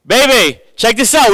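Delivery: high, loud excited call; excited calling wife. Retold or imitated. Husband calling his wife to watch the fight, excited. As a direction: call the wife over, thrilled. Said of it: "I'm like".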